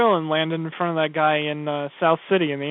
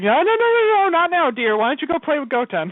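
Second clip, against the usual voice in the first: very high, louder mock sugary Bulma; fussy mom voice; 11 semitones higher, 3 dB louder than usual. Bulma hushing Trunks at the party, high fake-sweet mom. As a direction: shoo the kid away in a high sing-song. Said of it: "Bulma's just like".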